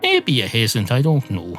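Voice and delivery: ordinary delivery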